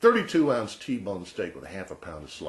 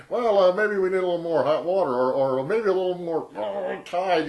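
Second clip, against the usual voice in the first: high, loud, flustered salesman; frantic pitchman; 9 semitones higher, 7 dB louder than usual. Retold or imitated. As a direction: scramble to save the failing demo. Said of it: "he's getting more and more frantic".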